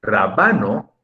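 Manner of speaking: "Rábano" said as raBAno; middle-syllable stress. Shown as a demonstration. Teacher explaining to Mercedes why it is not grave, "Rábano" stressed on BA. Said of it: "porque ahí sería ba".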